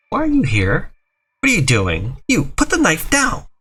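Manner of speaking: high, slow alarmed cry; alarmed mother. Acted. Mother catching her son mid-fight, high and alarmed.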